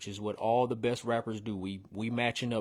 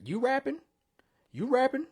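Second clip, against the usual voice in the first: high, delighted question; hyped-up disbelief; much higher, thrilled "you rapping?"; excited; 14 semitones higher, 4 dB louder than usual. Real, not acted. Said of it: "excited praise of rapping".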